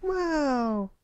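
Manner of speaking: high, bright exclaimed wow; excited gasp. A narrator's reading. Filly marvelling at the roaring campfire, excited. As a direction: gasp in wonder at the fire. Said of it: "Sweetie Belle exclaimed".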